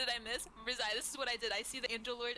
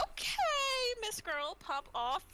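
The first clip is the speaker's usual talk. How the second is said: high squealing sass, then settling; little sassy; 12 semitones higher, 6 dB louder, much slower than usual. Acted. Mouse cheers on the angel, high and sassy. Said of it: "Like a little sassy".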